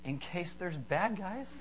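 put-on soft, slow, childlike answer; little boy voice. Kid explains himself to the video store clerk, little boy voice. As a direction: draw it out quietly like an earnest small kid.

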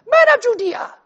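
very high, loud joke voice; hammed-up squeaky proclamation to a crowd; Peter. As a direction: put on a silly, piercing high voice. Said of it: "I will try and do it in Peter voice".

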